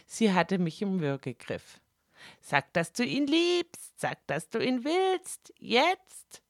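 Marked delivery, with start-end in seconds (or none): 2.4-6.4 high, pressing hiss into the ear; Fräulein Zwang hissing. Acted. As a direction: goad her, close and urgent. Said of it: "zischte sie mir ins Ohr". (Fräulein Zwang zischt hoch und drängend ins Ohr)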